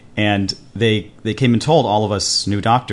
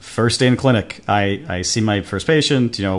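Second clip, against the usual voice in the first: ordinary talk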